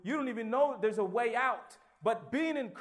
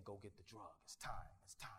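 tempter's murmur; 11 semitones lower, 18 dB quieter than usual. Retold or imitated. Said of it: "the beast was saying".

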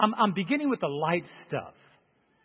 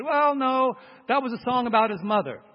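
lightly voiced objector, higher pitch; dismissive objector; 6 semitones higher than usual. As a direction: imitate someone brushing the point aside. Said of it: "somebody once said".

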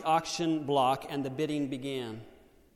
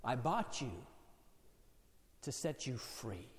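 soft, low tender reveal; 3 semitones lower, 10 dB quieter than usual. Acted. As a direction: say it gently, with deep love.

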